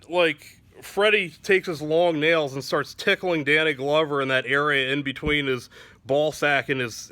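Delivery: ordinary delivery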